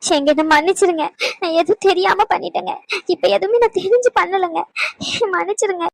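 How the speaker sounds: weeping plea with gasping breaths between phrases; tearful apology. Acted. Wife begs departing husband's forgiveness, tearful apology. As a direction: beg forgiveness through sobs. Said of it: "சும்மா சும்மா அழுதுகிட்டு இருக்காது".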